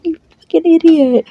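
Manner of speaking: high, loud, scolding outburst; angry yelling. Acted. Ash scolds Larry for scaring her half to death, yelling. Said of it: "yelling at him".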